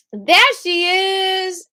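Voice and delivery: stretched high, loud welcome; excited greeting. Real, not acted. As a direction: sing out a big hello. Greeting a student joining class.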